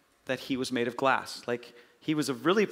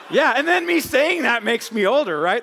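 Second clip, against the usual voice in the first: higher and louder, laughing through the words; tickled by your own joke; laughing while talking; 8 semitones higher, 7 dB louder than usual. Real, not acted. Admitting the reference dates him, laughing.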